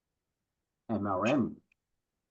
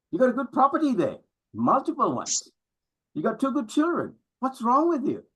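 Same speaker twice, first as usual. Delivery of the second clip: incredulous father; 9 semitones higher than usual. Retold or imitated. Father scolding the son.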